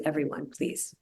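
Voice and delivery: ordinary talk, nothing marked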